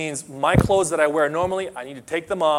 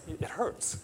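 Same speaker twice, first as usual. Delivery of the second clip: hushed admission; 5 semitones higher, 14 dB quieter than usual. Real, not acted. Admitting hurt.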